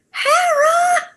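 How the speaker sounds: high, pained cry of a name; pained shout. Retold or imitated. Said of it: "clutching herself and saying".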